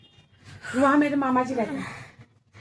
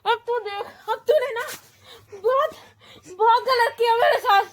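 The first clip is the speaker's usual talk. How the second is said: angry tearful threat; 6 semitones higher than usual. Acted. Victim raging and crying.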